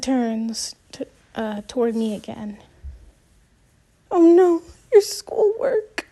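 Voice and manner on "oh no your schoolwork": high, slow, tearful wail breaking into sobs; mother dissolving into tears; crying mother. A narrator's reading. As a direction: cry out over the schoolwork. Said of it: "She begins to cry again".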